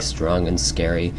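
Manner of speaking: usual speaking voice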